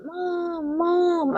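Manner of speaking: put-on high, thin baby voice calling for mom; baby crying for mom. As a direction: cry out for mom like a tiny child. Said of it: "and I'm yelling".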